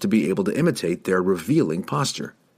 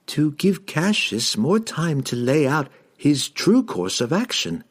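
slow, higher-pitched dazed servant; feigned entranced halfling; 8 semitones higher than usual. A narrator's reading. Halfling fakes being charmed for the wizard, slow and dazed. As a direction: answer slowly as if under a spell.

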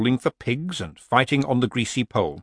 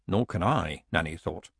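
usual speaking voice

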